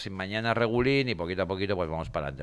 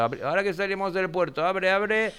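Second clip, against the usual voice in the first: higher, urgent ship's crew voice; sailor barking orders; 9 semitones higher than usual. Acted. Imita a un marinero dando órdenes. (marinero dando órdenes con urgencia)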